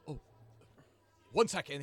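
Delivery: guard calling out. Acted. Guard calls up to the tower.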